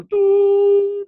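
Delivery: very high, loud sustained horn call; shofar blast imitation. Shown as a demonstration. Rabbi imitating the shofar for listeners, loud horn blast. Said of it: "And then I sound the shofar".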